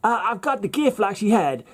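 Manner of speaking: high, hammed-up Southern accent; worst Southern accent. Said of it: "That's the worst accent ever".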